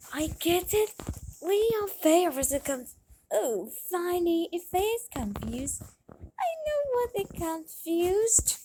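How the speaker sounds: a high, lightly put-on girlish voice; high storybook girl voice; high character voice. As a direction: play the young girl brightly.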